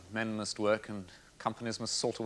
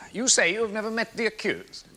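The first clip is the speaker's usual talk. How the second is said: high, incredulous-sounding question; pointed challenge; 12 semitones higher, 6 dB louder than usual. Acted. Barrister challenging the witness's denial, pointed and raised. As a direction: lift the voice sharply to press the point.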